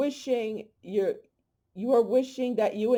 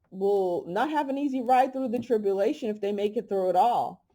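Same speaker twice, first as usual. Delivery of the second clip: ordinary talk, nothing marked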